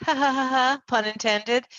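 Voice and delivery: light burst of laughter; laughing. Real, not acted. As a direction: laugh at your own pun. Laughs at own pun.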